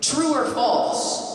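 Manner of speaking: playful teacher quizzing the audience; quiz host. Pretend. Quiz host posing question.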